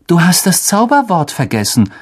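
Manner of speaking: mildly irritated retort; a slight edge of annoyance. Acted. Harry correcting his cousin, mildly irritated. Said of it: "sagte Harry gereizt".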